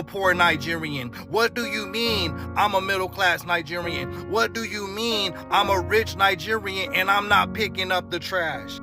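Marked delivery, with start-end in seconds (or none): none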